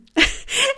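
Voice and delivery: short rising giggle; a quick high giggle; real laugh. Real, not acted. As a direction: giggle at her own parody. Laughs after own parody.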